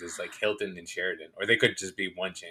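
ordinary delivery

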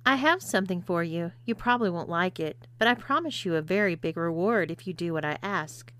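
plain reading voice

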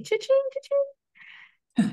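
high, drawn-out "cha-ching"; playful high-pitched money sound; cash register imitation. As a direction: sing out a cash-register ring.

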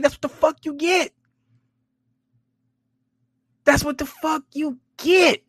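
high, squealed gloating through amusement; laughing at him. Acted. Vlogger laughing at the man who lost out, high amused gloating. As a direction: crow it high, holding back laughter. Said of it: "but I'm laughing at him".

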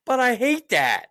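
high and loud, a worked-up tirade; heated rant. Real, not acted. Venting to a friend about rude shoppers, loud and worked up. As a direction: let the fury run away with you.

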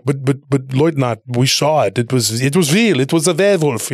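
stammered protest in a mock German accent; light, put-on German accent, pleading to be believed. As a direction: imitate insistent soldiers with a German accent. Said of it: "speaking English with a German accent".

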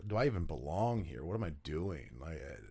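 hushed retold inner voice; self-doubting. Rookie questioning himself on entering the NFL, quiet self-doubt. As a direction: drop soft and low, doubting yourself. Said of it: "filled with so much just, like, self-doubt".

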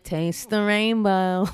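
slow, hammed-up line in her voice; mock Fran Drescher. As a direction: tease her style with a slow slogan. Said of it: "I mocked it a little bit".

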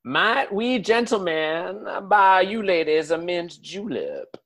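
mock-gallant southern gentleman accent; genteel southern accent. Southern gentleman offering ladies a drink, genteel southern accent. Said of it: "genteel way that you guys talk".

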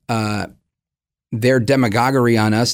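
usual speaking voice

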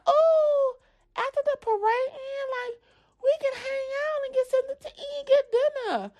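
put-on high, eager child voice; little girl's voice. Little girl inviting them to dinner, high child voice. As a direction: chirp it like a hopeful kid.

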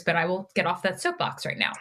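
ordinary talk, nothing marked

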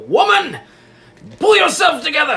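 loud, high, exasperated husband; man mad at wife. Acted. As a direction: bark it at your hysterical wife. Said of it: "my impersonation of man mad at wife".

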